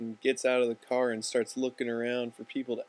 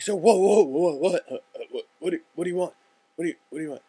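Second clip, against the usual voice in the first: startled stammer; 6 semitones higher, 7 dB louder, faster than usual. Retold or imitated. Startled bum jolted awake.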